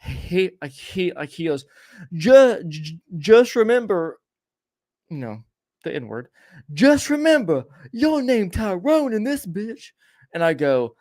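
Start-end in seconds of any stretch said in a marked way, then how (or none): 2.1-4.2 high, loud yell down the stairs; retold scream. 6.7-9.9 retold scream.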